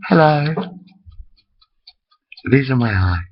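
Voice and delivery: normal talking voice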